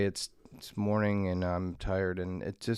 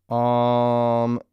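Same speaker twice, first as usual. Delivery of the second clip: long loud hum; 3 semitones higher, 9 dB louder than usual. Acted.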